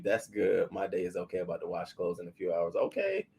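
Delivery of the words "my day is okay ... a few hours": usual speaking voice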